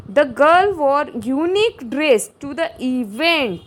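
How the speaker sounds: very slow, loud, deliberate reading; slow dictation read. Shown as a demonstration. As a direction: read each word out slowly to the class. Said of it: "reading exam sentence slowly".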